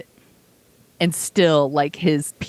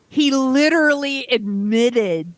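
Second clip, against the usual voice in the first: higher, slow and drawn out, exasperated; exasperated disbelief; 7 semitones higher, slower than usual. Retold or imitated. Fed up with people who defend him, slow exasperated. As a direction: spell it out slowly, as if to a child. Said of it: "And I'm like".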